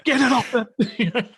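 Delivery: exasperated order. Retold or imitated. Soldier objecting to tea on the command post table, exasperated. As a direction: order it away, pitch jumping up.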